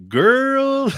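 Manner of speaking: high, loud, drawn-out sassy call. Acted. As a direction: exclaim it with sassy attitude, way up high. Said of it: "your guides are being all sassy".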